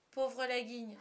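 usual speaking voice

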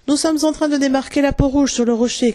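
a mild shout across the water; calling out. A narrator's reading. Smee reporting to his captain from the rock, calling out. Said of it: "cria Smee".

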